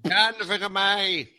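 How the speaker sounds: excited. Real, not acted. Player reacts loudly.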